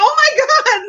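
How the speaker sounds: high-pitched, stunned exclamation; amazed high squeal; retold astonishment. Retold shock at whited-out name.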